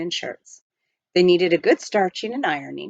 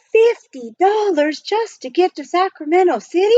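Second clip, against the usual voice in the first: shocked outburst; 9 semitones higher, 5 dB louder than usual. Acted. Jack's shocked outburst at the price.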